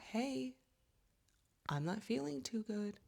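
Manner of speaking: high, soft little voice for the aching back; voicing her back. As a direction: play the back as a small whining friend. Her back speaking as a character.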